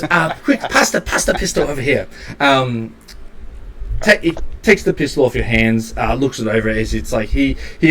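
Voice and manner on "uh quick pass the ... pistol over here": slightly raised, hurried character voice; lightly voiced shopkeeper. Shopkeeper asking for the pistol back, lightly urgent. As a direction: urge them as the impatient inventor. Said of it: "and he goes".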